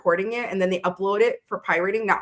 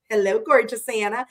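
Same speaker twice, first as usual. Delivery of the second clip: ordinary talk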